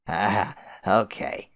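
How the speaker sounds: slow, spaced-out okay; dazed agreement. Acted. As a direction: drawl it out lazily. Clown troll accepting meekly.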